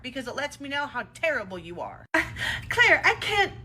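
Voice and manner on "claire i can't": loud, high, teary pleading; crocodile tears. Acted. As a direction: beg loudly like fake crying. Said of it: "your manipulative little crocodile tear".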